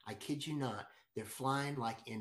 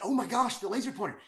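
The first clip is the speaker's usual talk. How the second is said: higher and louder, sudden excited recall; excited realization; 10 semitones higher, 9 dB louder, faster than usual. Retold or imitated. He remembers the laser pointer, excited burst. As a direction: snap to it, thrilled.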